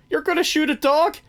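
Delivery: high mock villain asking in disbelief; imitating Jack Horner. Fixing the misquote for the co-host, high mock voice. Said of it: "or, sorry".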